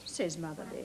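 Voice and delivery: usual speaking voice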